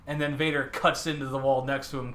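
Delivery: normal talking voice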